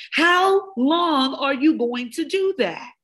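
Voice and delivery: slow and high, every word spelled out; deliberate, slowed challenge; slow drawn-out question. Acted. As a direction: stretch it out so each word lands. Repeated question, fast then slow.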